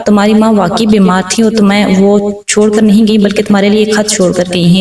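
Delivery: ordinary delivery, nothing marked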